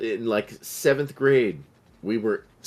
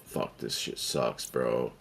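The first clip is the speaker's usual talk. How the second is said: bored whispered gripe; 10 semitones lower, 9 dB quieter, slower than usual. Retold or imitated. Bored teen muttering in car.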